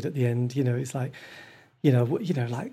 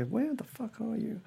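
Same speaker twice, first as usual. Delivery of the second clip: higher, slower, softer mock demand; mock annoyed partner; 8 semitones higher, 7 dB quieter than usual. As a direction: playfully imitate a cross partner. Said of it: "my partner's gonna go".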